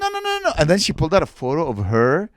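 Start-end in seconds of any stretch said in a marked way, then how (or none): 0.0-0.5 very high, rapid-fire protest; pitched-up mock female voice; woman's reassurance. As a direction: imitate her waving off an apology. Voices woman waving off apology.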